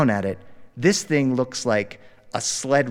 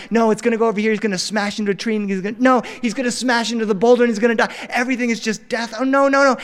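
higher, fast rising panic; panicked dad; 8 semitones higher, faster than usual. Retold or imitated. Dad watching his son head for the boulders, panicking. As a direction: rattle it off in mounting dread.

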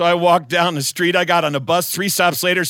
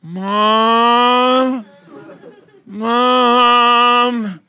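high whiny call for mom; 5 semitones higher, 6 dB louder than usual. Retold or imitated. Child calling mother from bed.

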